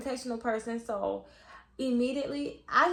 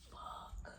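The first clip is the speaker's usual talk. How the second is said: voiceless whispered curse; hushed, retold exasperation; muttered dismay; 19 dB quieter than usual. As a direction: curse under your breath. Dread at having to see him.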